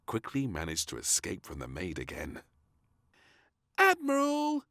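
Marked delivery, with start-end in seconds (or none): 3.8-4.6 high, loud call across a pond. A narrator's reading. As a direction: call him from far away. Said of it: "she called across the pond".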